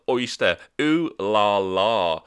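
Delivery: drawn-out mock-posh "ooh la la"; slow, hammed-up posh relish; mock Tory posh. Mocking the London travel card's name, slow Tory posh. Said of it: "the most Tory sound and travel card".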